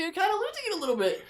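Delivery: very high, fast, breaking into laughter; real laughter; laughing. Real, not acted. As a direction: rush it out laughing. Laughing at own point.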